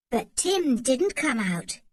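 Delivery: ordinary delivery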